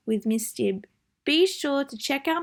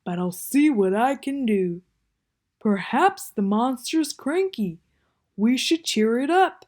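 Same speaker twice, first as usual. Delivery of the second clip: Bear's voice; 4 dB louder than usual. Acted. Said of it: "said Bear".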